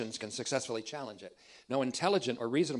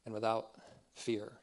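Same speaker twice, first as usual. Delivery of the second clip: soft, hushed prayer to God; closing a prayer softly before the lesson; 6 dB quieter than usual. Real, not acted. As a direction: pray it quietly and reverently.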